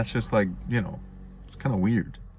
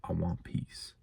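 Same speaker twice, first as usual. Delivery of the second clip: quiet plea; 3 semitones lower, 10 dB quieter than usual. Retold or imitated. Imitating someone finally wanting peace.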